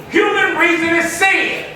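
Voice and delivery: ordinary delivery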